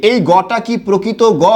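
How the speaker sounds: usual speaking voice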